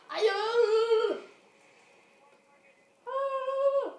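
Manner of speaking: high, loud excited yell. Real, not acted. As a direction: yell in delight. Excited missile launch.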